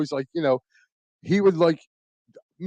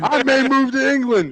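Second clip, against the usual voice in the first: mock British accent; 9 semitones higher, 6 dB louder than usual. Loving English stuff, jokes moving.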